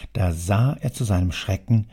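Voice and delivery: usual speaking voice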